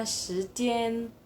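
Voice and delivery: "时间" rising on 时, level on 间; second tone, first tone. Shown as a demonstration. Teacher reading out the word for time, tones shown carefully. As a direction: lift 时, then hold 间 even. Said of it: "berarti dibacanya".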